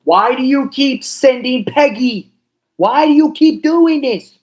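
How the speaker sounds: high, loud, slow whiny complaint; a put-on voice yelling in frustration; exasperated character voice. Exasperated character complaining about Peggy.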